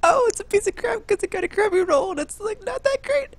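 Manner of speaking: high-pitched whine of mock dismay; high, hammed-up letdown; mock disappointment. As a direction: whine it high, let down by the loot. Acts out disappointment at the loot.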